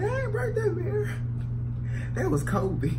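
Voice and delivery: put-on high, whining kid protesting innocence; whiny kid denial. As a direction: plead like a caught child. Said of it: "I was like".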